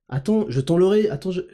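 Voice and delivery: ordinary talk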